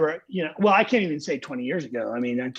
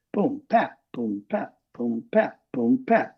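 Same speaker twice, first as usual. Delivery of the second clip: drum beat vocalized; slower than usual. Shown as a demonstration. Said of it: "you go".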